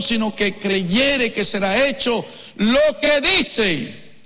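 loud, lifted scripture proclamation; preaching. Acted. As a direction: read the verse out with pulpit force.

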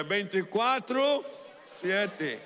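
loud, high, drawn-out declaration; full-mouthed proclamation. Shown as a demonstration. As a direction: fill your mouth and proclaim it slowly. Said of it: "llene su boca y diga".

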